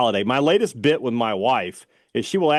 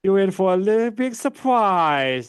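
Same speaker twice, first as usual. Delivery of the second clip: South Park impression. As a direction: imitate the cartoon character. South Park impression just discussed.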